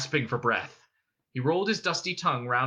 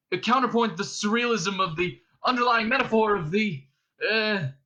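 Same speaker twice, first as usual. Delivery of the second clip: higher, hesitant bluffing; floundering; 7 semitones higher, 4 dB louder than usual. A narrator's reading. Ford comes to Arthur's rescue, floundering. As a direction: push on higher, then stall on an uh. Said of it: "he floundered too".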